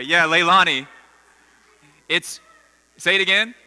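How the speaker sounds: loud call across the room. Real, not acted. Calls on someone at the back.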